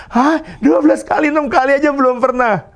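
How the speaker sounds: high, startled disbelief, quick at the end; shocked director. Retold or imitated. Director hearing the twelve-times order, stunned and high. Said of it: "Si direktur ini kaget".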